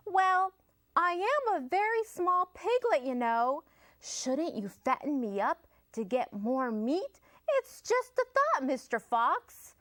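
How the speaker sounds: high, sweet piglet pleading; sly piglet voice. Acted. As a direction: coax the fox innocently. Piglet voice in story.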